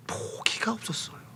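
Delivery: breath-only hushed whisper; emphatic whisper. Real, not acted. Preacher stressing blind man's persistence, whispered. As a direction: drop to a whisper for weight.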